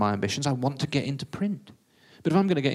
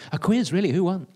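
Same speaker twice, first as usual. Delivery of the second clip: higher, louder eager questions; eager pouncing; 6 semitones higher, 5 dB louder than usual. Acted. Reporter pounces on quiz news, high and eager. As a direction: seize on it with sudden keenness.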